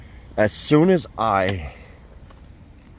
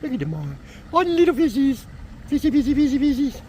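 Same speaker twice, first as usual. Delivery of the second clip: cooing; 14 semitones higher than usual. Real, not acted. Cooing high at the little fish in the pen. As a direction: coo at the little fish like pets.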